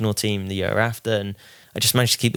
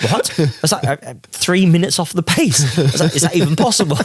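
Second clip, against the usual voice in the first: higher and louder, stunned disbelief; incredulous disbelief; 8 semitones higher, 5 dB louder than usual. Retold or imitated. Driver told his lap time, stunned and incredulous. Shocked at being three minutes off.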